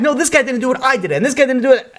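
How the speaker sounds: high-pitched retold arguing; acted-out frantic insistence; heated back-and-forth. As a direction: protest over each other to take the blame. Friends argue back and forth.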